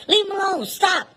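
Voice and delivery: put-on high shout to stop the kids; urgent shout. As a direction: yell at them to let go, high. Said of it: "grab the kid".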